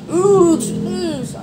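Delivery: very high-pitched restarting count; shouted count. Shown as a demonstration. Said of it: "kencang hucu lagi". (teriakan hitungan latihan bernada sangat tinggi)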